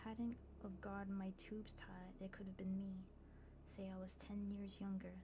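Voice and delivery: hushed, whispery story reading; soft ASMR reading. Acted. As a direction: read it softly for ASMR listeners.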